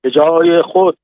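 shouted order. A narrator's reading. Sergeant to soldiers as paper birds fly, shouted order. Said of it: "داد زد".

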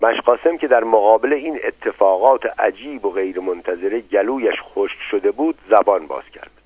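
ordinary delivery

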